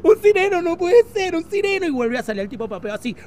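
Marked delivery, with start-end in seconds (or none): 0.0-1.9 high, drawn-out exclamation; hammed-up amazement. Man on beach spotting a merman, hammed-up amazement. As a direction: cry it out like a thrilling sighting.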